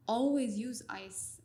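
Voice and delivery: each word drawn out slowly; slow emphatic mnemonic. Shown as a demonstration. Coaching a colleague on patient care, slow and emphatic. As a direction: spell out the rule slowly so it sticks.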